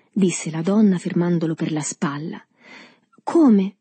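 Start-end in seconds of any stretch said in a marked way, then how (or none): 3.2-3.8 louder, higher startled question; domanda senza timidezza. A narrator's reading. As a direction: blurt the question out boldly. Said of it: "perdendo ad un tratto la timidezza". (domanda decisa, a voce più alta)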